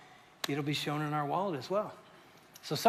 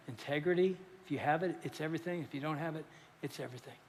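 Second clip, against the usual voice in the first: softer and softer, fading to a hush; quiet weighty saying; 3 semitones lower, 9 dB quieter, faster than usual. Retold or imitated. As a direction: lay down the maxim quietly, letting it fade. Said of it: "this is when he said".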